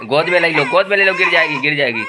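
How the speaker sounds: loud, high-pitched urgent call; urgent warning. Real, not acted. Father telling family to pick up the falling child, urgent shout.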